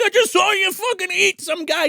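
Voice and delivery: high, mock-hysterical accusation; hammed-up shocked son; freaked-out son. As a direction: son panicking at mom's cannibalism. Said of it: "He's like".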